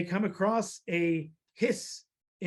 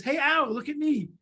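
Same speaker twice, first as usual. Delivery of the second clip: high-pitched mouse hailing an owl; calling out; 7 semitones higher than usual. Acted. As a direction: pipe up high to get attention. Mouse boldly calls to owl.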